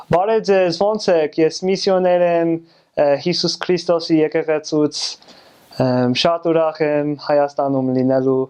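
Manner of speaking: fluent-sounding Armenian accent; better Armenian accent. Shown as a demonstration. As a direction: speak with an Armenian accent, giving each syllable its weight. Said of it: "a better accent".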